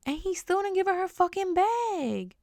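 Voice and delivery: high-pitched indignant girl imitation; mock high outraged voice; girl's high voice. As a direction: mimic her outrage up high. Girl voiced in retold story.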